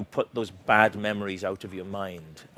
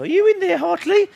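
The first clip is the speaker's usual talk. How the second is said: very high, louder squeaky pig calling through a door; Pig puppet voice; 7 dB louder than usual. Retold or imitated. Pig knocking on the bathroom door for Hartley, very high and louder. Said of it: "Pig would be knocking on the door".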